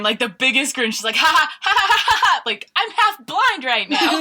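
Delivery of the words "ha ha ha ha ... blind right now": high, bubbly giggles that spill into the words; imitating giggling child. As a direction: giggle through it like a delighted little girl. Retelling her girl laughing.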